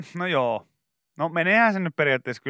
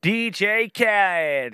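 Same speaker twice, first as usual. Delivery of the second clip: high, slow hype-man call; 7 semitones higher, 3 dB louder, much slower than usual. Retold or imitated. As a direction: stretch it out like a hype intro. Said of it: "hän huutaa".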